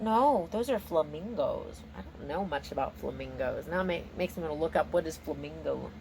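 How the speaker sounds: usual speaking voice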